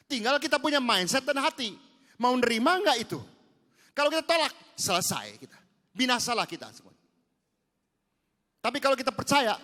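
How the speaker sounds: loud, high, slowed preaching; preaching at full force. Acted. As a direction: press the point home to the congregation.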